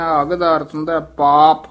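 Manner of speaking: usual speaking voice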